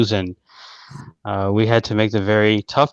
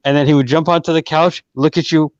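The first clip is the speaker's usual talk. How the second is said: usual speaking voice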